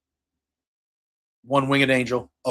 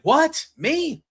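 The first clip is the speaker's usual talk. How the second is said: mock surprise; 11 semitones higher than usual. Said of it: "he's like".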